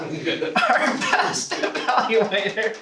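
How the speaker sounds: laughing through the words; slow, laughing award announcement. Real, not acted. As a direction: announce it while still laughing.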